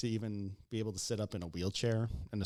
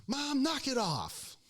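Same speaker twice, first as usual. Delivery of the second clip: high, drawn-out exasperated telling-off; no-nonsense scolding; 13 semitones higher, slower than usual. Retold or imitated. Daughter scolds her fussing mother, high mock exasperation. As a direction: imitate a fed-up daughter. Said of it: "her daughter takes no shit".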